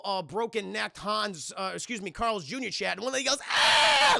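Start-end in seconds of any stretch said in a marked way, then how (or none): 3.5-4.2 loud, high mock scream of shock; sudden comic shriek. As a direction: imitate a woman screaming at a dead body. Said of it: "Lady screams when she sees".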